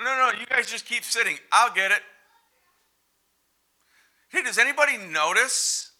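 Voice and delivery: martyred complaint, half shouted; exasperated Martha. Acted. Martha fussing at guests who keep sitting, exasperated. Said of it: "I've been there as Martha".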